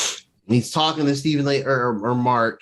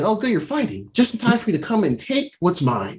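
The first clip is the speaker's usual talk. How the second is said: lightly voiced villain, pitched up; retold gloating villain; 7 semitones higher than usual. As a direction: play the pleased villain in a higher retelling voice. Voices Arthur Harrow interrupting the quarrel.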